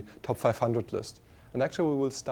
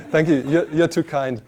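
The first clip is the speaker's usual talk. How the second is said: real embarrassed laugh through the words, louder; laughing thanks; 3 semitones higher, 9 dB louder than usual. Real, not acted. Lecturer laughing as a student corrects his pronunciation.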